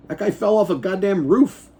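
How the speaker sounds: ordinary talk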